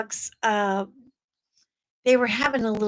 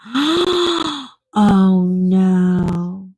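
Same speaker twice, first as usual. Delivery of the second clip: gasp, then a loud dismayed oh no; sudden horrified intake of breath; gasping oh no at the news; shocked gasp; 3 semitones lower, 6 dB louder than usual. Real, not acted. Horrified at dog attack story.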